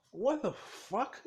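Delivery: high, drawn-out disbelief; startled disbelief. Acted. Teacher finds him passed out in the bathtub, startled disbelief.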